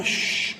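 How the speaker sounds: long hushing "shh"; sustained voiceless shush; shushing sound. Acted. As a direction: shush someone with a drawn-out hiss. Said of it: "उसने उसके आवाज में कहा".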